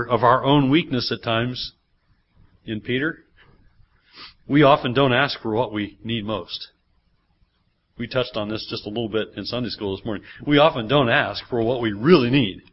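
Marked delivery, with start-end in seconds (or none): none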